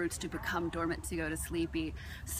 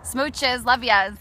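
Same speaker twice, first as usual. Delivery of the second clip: louder, higher blown-kiss goodbye; gushing sign-off; 4 semitones higher, 8 dB louder, slower than usual. Real, not acted. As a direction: send them off with a loud happy goodbye. Sign-off to viewers.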